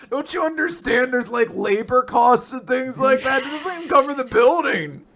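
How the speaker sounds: high, loud mock tirade; hammed-up indignant shouting; outraged rant. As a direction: yell it like a devil cheated on a deal. Acting out outraged attorney devil's rant.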